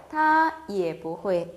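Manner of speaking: each syllable spelled out slowly; slow model reading. Shown as a demonstration. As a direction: read it slowly for learners. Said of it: "그녀도 할 줄 몰라요". (천천히 또박또박 읽는 예문)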